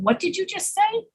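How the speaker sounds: acted confusion, lifting the word say; puzzled query. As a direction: ask as if you misheard, voice climbing to say. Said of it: "you have to ask them".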